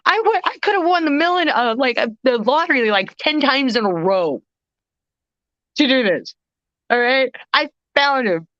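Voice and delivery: high, lively telling of the find; excited disbelief. Real, not acted. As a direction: burst with giddy pride. Euphoric retelling of the find.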